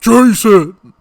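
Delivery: loud calling of a name from far off; a voice that deep calling out. Retold or imitated. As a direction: bellow the name across the woods. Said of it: "you hear somebody go".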